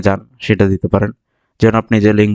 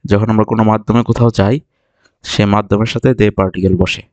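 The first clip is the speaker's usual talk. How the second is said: ordinary delivery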